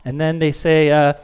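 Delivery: ordinary delivery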